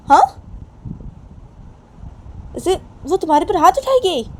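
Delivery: high, heated telling-off of a daughter; angry scolding father. Acted. Father blames Sunaina, quarrel. (गुस्से में ऊँची आवाज़ में डाँटते पिता)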